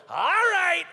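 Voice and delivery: high, loud sing-song barmaid; barmaid call. Retold or imitated. Barmaid opens the door for the skunk, high sing-song.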